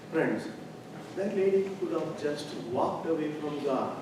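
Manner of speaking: ordinary talk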